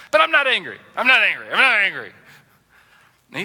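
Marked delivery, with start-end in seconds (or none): 0.1-2.1 loud, emphatic snapped denials; acted heated self-contradiction; angrily denying anger. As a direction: insist on calm while clearly fuming. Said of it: "that's how we justify our anger".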